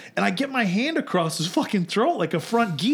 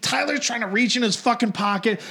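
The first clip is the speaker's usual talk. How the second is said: usual speaking voice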